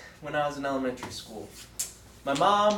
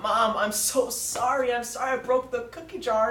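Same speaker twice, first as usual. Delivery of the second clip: pleading; 8 semitones higher than usual. Retold or imitated. Preacher retells saying sorry to his mom, mock pleading. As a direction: imitate a sorry child begging mom, pitched up. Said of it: "I pleaded to my mom".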